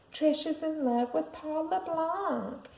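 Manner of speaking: high, slow mocking singsong; teasing singsong. A narrator's reading. Brother teasing his sister at her doorway, high singsong. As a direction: taunt her in a sugary lilt. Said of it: "made kissing sounds and said".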